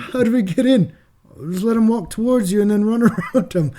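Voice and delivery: mock-Australian casual back-and-forth; bad Australian accent. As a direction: do a poor Australian accent, laughing into it. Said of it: "That's my Australian accent, really bad".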